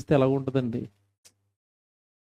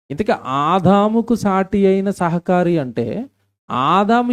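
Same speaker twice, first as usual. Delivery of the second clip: loud, much higher preaching cry; full-force preaching; 7 semitones higher, 4 dB louder than usual. Acted. Pastor challenging the women in church, loud. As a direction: drive the question home to the crowd.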